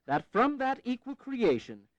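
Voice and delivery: slow, deliberate declaration reading; slow formal reading. Acted. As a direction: pronounce each phrase with care. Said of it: "Strike an independent".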